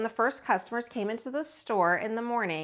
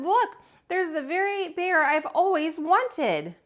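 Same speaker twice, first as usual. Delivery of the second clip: put-on high, loud excited child; little girl voice; 7 semitones higher, 4 dB louder than usual. Little girl calling to her mother, excited. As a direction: squeal it like a girl who spots her toy. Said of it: "she said".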